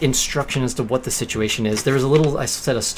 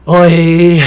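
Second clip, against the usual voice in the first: very loud, high, long drawn-out call; overdone sighing call; 6 semitones higher, 14 dB louder than usual. Retold or imitated. Botching the last line of an audition, overdone loud call. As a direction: belt it out long and loud, theatrically exasperated. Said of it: "looking away and sighing".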